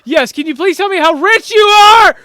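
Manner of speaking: high, loud shout. Pretend. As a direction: yell into the voicemail. Shouting into voicemail.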